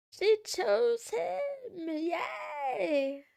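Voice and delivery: high, slow, teary gush; almost crying with happiness; emotional squeal. Real, not acted. Moved by the show's couple, teary high gush.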